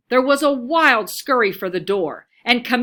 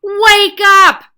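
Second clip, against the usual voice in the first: loud, high yell at a leaf; 8 semitones higher, 12 dB louder than usual. A narrator's reading. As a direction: shout to wake the leaf up. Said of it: "she yelled".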